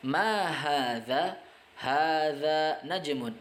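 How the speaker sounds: Ma hadza rising slowly, then Hadza baitun falling; teacher drilling Arabic question and answer. Shown as a demonstration. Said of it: "apa ini? Ini adalah bintang".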